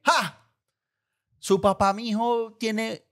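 mother's scoff. Retold or imitated. Mother mocking the father's paranoia, scoffing. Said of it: "Y mi mamá es que".

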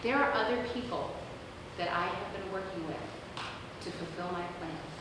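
low, soft reassurance; hushed, measured divine voice; God's little voice. Acted. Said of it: "in that little voice".

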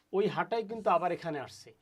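ordinary delivery

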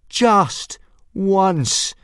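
high, loud yell at the sky; furious yell. A narrator's reading. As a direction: yell up at the heavens in fury. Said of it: "yelled Hiccup".

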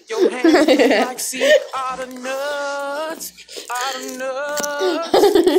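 drawn out through laughter; slow laughing roast. Acted. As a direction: cackle the insult slowly. Roasting customer, laughing, slowly.

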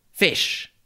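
high-pitched, short mock call; mock shout. Soldier calling to a comrade on the beach, mock shout. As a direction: call it out like a lightly imitated shout. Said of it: "called out to each other by shouting".

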